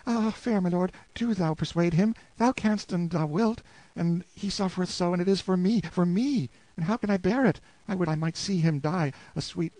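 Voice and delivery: put-on high, trembling plea of a desperate young wife; pleading wife. Anguished wife begging the lord to persuade Hugo, high pleading voice. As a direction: beg him to save your husband.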